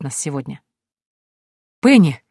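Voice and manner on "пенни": bright, louder happy shout of a name; joyful call. A narrator's reading. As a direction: call out gladly across the yard. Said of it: "на радостный возглас".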